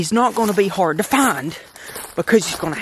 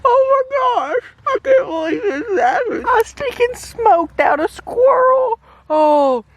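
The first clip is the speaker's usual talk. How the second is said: very high, tearful squeal of joy; overjoyed squeal. Real, not acted. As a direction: squeal with happy disbelief. Overjoyed after long hunt.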